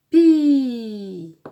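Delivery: loud, stretched "pi" sliding downward; drawn-out syllable. Shown as a demonstration. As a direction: hold "pi" on a long falling glide. Model answer in syllable game.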